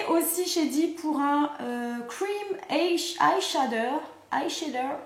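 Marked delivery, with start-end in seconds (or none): 2.1-5.0 slow, careful English with a French accent. Real, not acted. Vlogger attempting product name for viewers, French-accented English. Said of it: "ceux qui aiment mon accent anglais".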